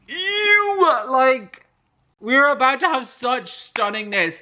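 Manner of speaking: high, far squeal of disappointment; squealing outrage. Real, not acted. Reader squealing as the romance scene is interrupted. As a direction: shriek your frustration at the interruption.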